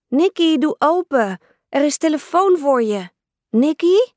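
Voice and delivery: little sister shouting through a locked door; calling out. Acted. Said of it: "riep Brianna".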